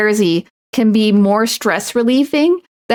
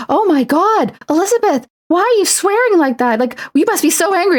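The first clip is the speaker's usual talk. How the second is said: high, swooping pearl-clutching scold; mock-shocked scolder; 9 semitones higher than usual. Bystander scolding a coder for swearing, mock-shocked. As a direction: imitate an aghast bystander, pitch way up. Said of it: "someone, like, nearby will be like".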